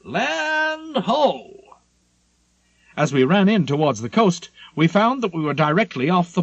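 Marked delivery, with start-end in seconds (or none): 0.0-1.8 high, ringing sailor's call from the bow. A narrator's reading. Lookout on the forecastle sighting land, calling out high. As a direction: sing out the sighting across the deck. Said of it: "a man on the forecastle called out".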